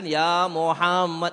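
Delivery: slow booming address; solemn call. Acted. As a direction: call out with divine authority. God's call, slow and loud.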